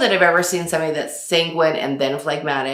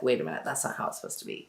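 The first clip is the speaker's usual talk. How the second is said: fast, soft muttering to yourself; quick soft mutter; muttered aside; 6 semitones lower, 10 dB quieter, much faster than usual. Retold or imitated. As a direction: rattle it off under your breath. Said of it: "I'm just like".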